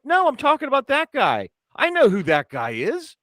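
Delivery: high, insistent mock protest; worked-up retelling of an argument; retold exasperation. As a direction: imitate a friend correcting you in frustration. Acted-out quarrel, friend protesting.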